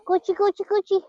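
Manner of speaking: high, sing-song coo to coax the birds. Real, not acted. Owner coaxing her chickens with a high cooing call.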